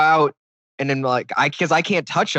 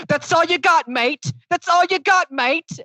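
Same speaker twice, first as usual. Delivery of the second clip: mock English accent; 13 semitones higher, slower than usual. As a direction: taunt like a schoolyard Brit. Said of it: "fake English accent yelling".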